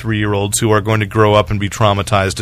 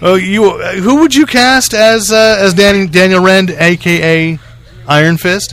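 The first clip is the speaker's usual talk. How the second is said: raised pitch, slow and eager; high-pitched question; 6 semitones higher, slower than usual. Acted. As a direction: ask it higher and slower. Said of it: "let's try that bit again".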